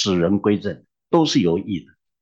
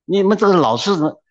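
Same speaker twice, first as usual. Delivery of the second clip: mock angry pastor, much higher and fast; 8 semitones higher, 3 dB louder, faster than usual. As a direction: retell the pastor's outburst, hammed-up and sped up. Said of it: "哇，这牧师更气了". (模仿生气的牧师，声音高而急)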